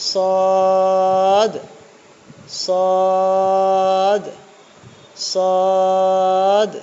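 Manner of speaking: সদ said loud, heavy and drawn out; emphatic sad. Shown as a demonstration. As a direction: give সদ a full heavy emphatic s. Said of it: "এটা হচ্ছে".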